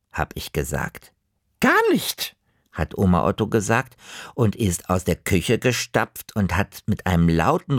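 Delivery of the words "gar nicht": hohe Omastimme, kurz und trotzig; trotzige Oma. Acted. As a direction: schmettere es ab wie eine sture Oma. (Oma, trotzig und hoch)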